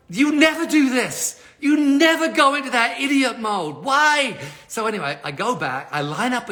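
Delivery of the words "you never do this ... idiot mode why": slow, high-pitched exasperated telling-off; stern self-scolding. Retold or imitated. [name] giving himself a whole talking to, exasperated scolding. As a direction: tell yourself off, high and drawn out. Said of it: "give myself a whole talking to".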